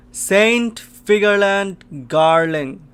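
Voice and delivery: loud, high, drawn-out exclamation; awestruck shout. Real, not acted. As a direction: shout the name slowly in astonishment. Astonished reveal of a character.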